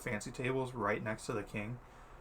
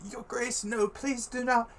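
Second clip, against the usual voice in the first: Menthor; 13 semitones higher, 3 dB louder than usual. Acted. Menthor pleads with the angry prince.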